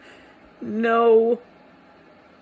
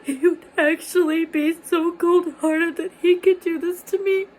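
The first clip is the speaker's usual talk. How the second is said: higher, louder, tearful and disbelieving; crying; 4 semitones higher, 4 dB louder than usual. Real, not acted. Recalling sitting alone at the table, crying with a higher, louder voice. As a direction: sob the words out in disbelief. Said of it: "I was sitting there crying like".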